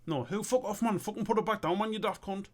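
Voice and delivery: high, urgent protest from a mate; panicked protest. Acted. Mate ordering his pal to put it back down, panicked. Acted panic between cartoon mates.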